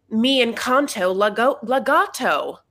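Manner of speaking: slow, heavily American attempt at Spanish; obnoxious American-accented Spanish. Shown as a demonstration. Host showing how bad it sounds, American-accented Spanish. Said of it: "Spanish with the most obnoxious accent".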